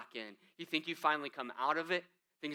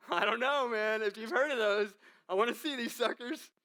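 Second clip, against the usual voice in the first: higher, louder laughing disbelief; amused disbelief; 6 semitones higher, 4 dB louder than usual. Real, not acted. Preacher joking to the congregation about attacking worms, laughing. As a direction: chuckle through it, amazed.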